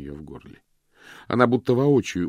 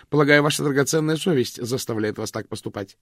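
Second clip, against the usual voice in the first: caustic; 7 semitones higher, 5 dB louder than usual. A narrator's reading. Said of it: "едко осведомилась она".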